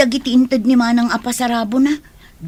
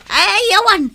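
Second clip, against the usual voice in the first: startled denial; 8 semitones higher, 7 dB louder than usual. Acted. Hiding something, denying quickly.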